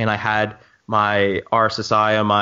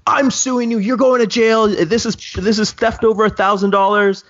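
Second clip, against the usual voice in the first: blackout anger; 10 semitones higher than usual. Retold or imitated. Reliving yelling at the woman who took his drone, furious shouting. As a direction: scream the threats like you've lost it. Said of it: "a blackout state of, like, anger".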